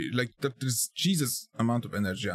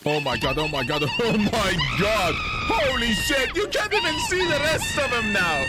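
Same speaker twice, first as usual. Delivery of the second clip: shouting in shock, much higher; excited shouting; 12 semitones higher, 3 dB louder than usual. Real, not acted. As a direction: yell it out, stunned and thrilled.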